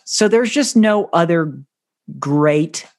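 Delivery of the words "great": "great" set apart and leaned on after a pause; "great" weighted like a keyword; stress on great. Shown as a demonstration. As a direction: land hard on "great" on its own. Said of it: "and I emphasize the word great".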